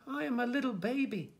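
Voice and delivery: put-on high-pitched baby plea; plaintive little cry. A narrator's reading. Baby answering a lady with a pram, crying out mildly. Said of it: "cried the little baby".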